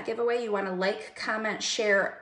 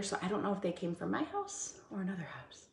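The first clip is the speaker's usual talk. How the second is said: whisper; 2 semitones lower, 9 dB quieter than usual. Real, not acted. Aside about visitors at her house.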